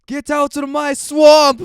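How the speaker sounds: loud, slow Shrek roar; Shrek impression. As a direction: bellow it like Shrek. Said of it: "Do a Shrek impression".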